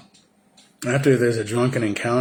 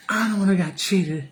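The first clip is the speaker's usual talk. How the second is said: put-on much higher, whining drunk complaint; whiny drunk; 10 semitones higher than usual. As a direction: whine like a sore loser. Drunk Ike arguing at poker.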